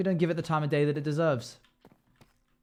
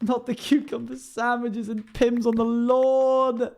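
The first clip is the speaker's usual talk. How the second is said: slow, high, loud mock-posh sneer; 8 semitones higher, 5 dB louder, slower than usual. Streamer mocks posh garden parties, in a hammed-up toff voice. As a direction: ham up a snooty aristocrat. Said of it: "I joke".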